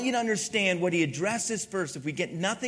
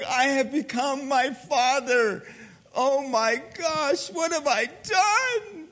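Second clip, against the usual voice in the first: slow, high-pitched hammed-up dismay; mock horrified lament; 8 semitones higher, 3 dB louder, slower than usual. Father realizing he became his own dad, slow mock-horrified wail. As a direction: drag it out like a melodramatic wail.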